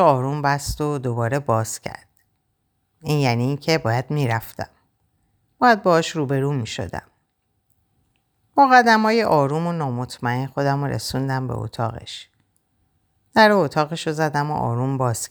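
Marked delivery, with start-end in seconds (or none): none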